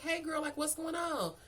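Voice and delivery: put-on high-pitched girlfriend hello; acted friendly greeting. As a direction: greet a coworker up high, retold.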